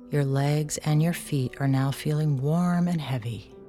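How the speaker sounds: normal talking voice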